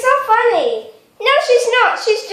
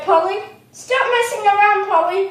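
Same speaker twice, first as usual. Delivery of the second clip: ordinary talk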